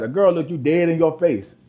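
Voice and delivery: normal talking voice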